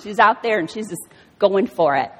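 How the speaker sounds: ordinary talk, nothing marked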